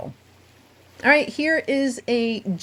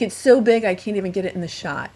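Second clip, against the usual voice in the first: ordinary delivery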